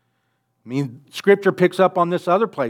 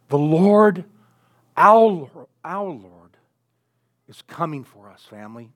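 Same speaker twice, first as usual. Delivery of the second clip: tender hush; 3 dB louder than usual. Real, not acted. Preacher swells then hushes.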